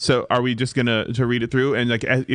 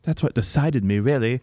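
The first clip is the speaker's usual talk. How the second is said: put-on grandly stagey British accent. Actress boasting of fan letters, theatrical British accent. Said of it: "my accent is superb".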